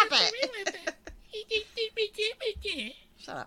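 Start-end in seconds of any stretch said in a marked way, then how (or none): 1.0-2.9 giggling fit. Real, not acted. Laughing fit.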